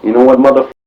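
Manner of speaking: re-enacted angry yell, pushing each word; imitated yelling. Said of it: "Rocky started yelling something like".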